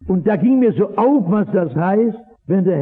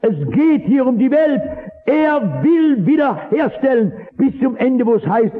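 preaching at full force; 6 semitones higher than usual. Acted. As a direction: lift the whole congregation.

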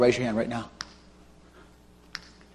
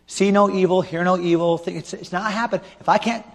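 usual speaking voice

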